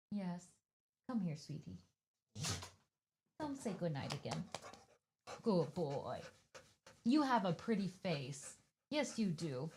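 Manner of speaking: hushed cooing to a dog; soft pet talk. Real, not acted. To the dog at bedtime, soft hushed cooing. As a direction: coo it softly like to a sleepy pup.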